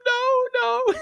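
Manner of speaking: very high, frightened little no; squeaky panicked no. Retold or imitated. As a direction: squeak it out like he's seen a hundred zombies. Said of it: "And he goes".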